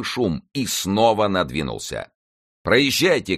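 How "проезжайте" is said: high, urgent command; shouted order. A narrator's reading. Officer sends the carriage onward, urgent shout. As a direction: call out to move on. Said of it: "крикнул Д'Артаньян".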